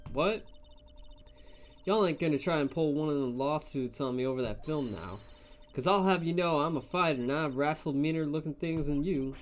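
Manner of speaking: put-on combative Southern accent; feisty, hammed-up Southern voice; exaggerated Southern drawl. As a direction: warn them off in a Southern drawl. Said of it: "those southern folks talk with that exaggerated drawl".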